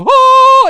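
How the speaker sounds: sudden high loud "ah" like a divine blast; mimicking a phone screen glaring like God's light, with a sudden cry; mock heavenly cry. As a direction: belt a mock angelic burst.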